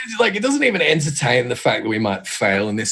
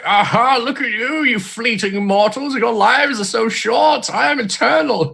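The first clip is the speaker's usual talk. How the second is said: slow, high, grandiose god boasting; 11 semitones higher than usual. Pretend. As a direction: lord it over tiny mortals. Acting Titan boasting to mortals.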